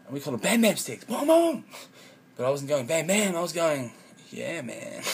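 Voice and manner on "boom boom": much higher, louder boom noise; imitated boom. Explaining bam bam sticks, loud high boom. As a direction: blast out the boom like an explosion.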